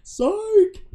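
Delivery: high, loud playful "psych"; spring the joke on them; teasing exclamation. Pretend. Teasing reversal of a story.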